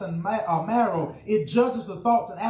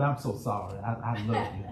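mock sheepish apology; 12 semitones lower, 6 dB quieter than usual. Acted reconciling spouse.

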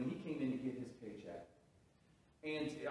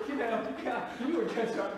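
louder and higher, fed-up retelling; annoyed outburst; 8 semitones higher, 6 dB louder than usual. Retold or imitated. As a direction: snap back at the pestering teen. Retold angry brush-off.

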